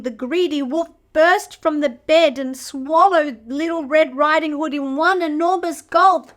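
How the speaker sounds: ordinary delivery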